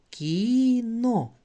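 «Кіно» high, long «Кі», then «но»; read syllable by syllable. Shown as a demonstration. Teacher reading the next word with children, «Кіно» stretched into syllables. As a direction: draw out «Кі» and separate «но» in «Кіно». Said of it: "прочитаємо наступне слово".

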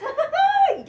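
very high, loud burst of laughter; real squealing laugh of delight. Real, not acted. Delighted at the card drawn.

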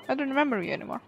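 ordinary talk

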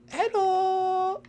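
tiny, very high hello; high-pitched game character. Acted. Character popping up to greet the seeker, squeaky.

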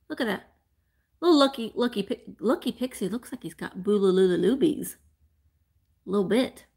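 ordinary talk

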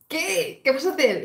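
high, eager little gasp of "what?"; mock excited disbelief. Viewer squealing at the screen in mock suspense. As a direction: squeal it like a fan on the edge of the seat. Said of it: "de esto que tú dices".

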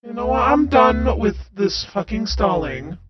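loud, fed-up outburst; angry shout. Acted. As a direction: snap and shout in exasperation. Character loses patience, yelling.

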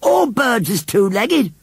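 put-on high, slow little boy's jeer. A narrator's reading. Tommy answers Missus Kibbins back, jeering. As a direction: taunt her in a drawn-out child's voice. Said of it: "jeered Tommy Higginsthwaite".

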